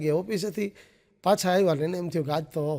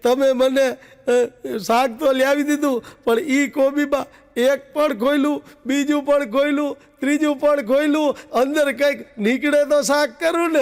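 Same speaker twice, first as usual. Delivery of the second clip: higher and louder tearful wail; mock crying wife; 6 semitones higher, 4 dB louder, slower than usual. Wife crying back at husband about the cabbage, mock wailing. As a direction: complain through mock sobs. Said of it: "બાઈ રોતી રોતી કહે".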